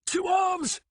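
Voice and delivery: high, loud alarm call; shout. Acted. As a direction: shout the alarm across the village. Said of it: "Bog shouted".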